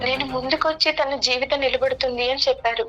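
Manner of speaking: normal talking voice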